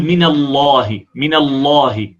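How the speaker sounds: من الله slow with a dark thick lam; heavy lam in Allah. Shown as a demonstration. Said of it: "نقول".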